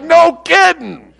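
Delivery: high, drawn-out retort; mock exclamation. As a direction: re-enact the kids blurting out the obvious. Kids reacting to calm dad.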